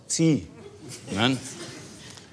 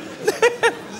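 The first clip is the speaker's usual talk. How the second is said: squeaky high laugh, barely voiced; high breathy laugh. Real, not acted. Laughs at own joke. (hohes, hauchiges Lachen)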